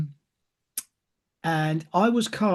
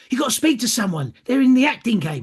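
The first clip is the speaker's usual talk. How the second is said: higher, louder mock call through the bedroom door; mum calling urgently; 7 semitones higher, 4 dB louder than usual. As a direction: imitate mum shouting him awake, higher and louder. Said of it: "banged on my bedroom door and said".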